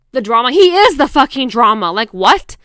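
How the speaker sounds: loud, incredulous outburst; indignant. Retold or imitated. She reacts to being called the drama, indignant. Retold outraged reaction to his remark.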